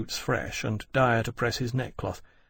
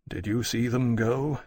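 ordinary delivery, nothing marked

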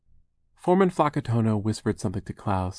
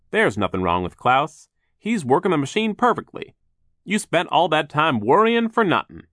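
high, loud, upbeat voice; cheerful worker voice; 8 semitones higher, 8 dB louder than usual. Acted. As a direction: reassure the worried sisters.

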